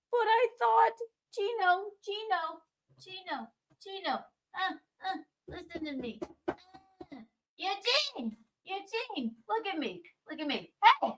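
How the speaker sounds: soft, urgent calling; quietly trying to get his attention; hushed urgency. Real, not acted. She quietly calls to a friend who has his headphones on.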